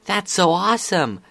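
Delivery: high, loud excited cheer. Acted. Scootaloo cheering her friend's good news, excited. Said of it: "Scootaloo cheered".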